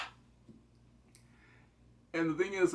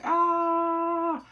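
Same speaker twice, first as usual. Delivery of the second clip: high, loud scream as he falls; mock falling scream; 7 dB louder than usual. Man falling into cement, high mock scream. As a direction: cry out while falling.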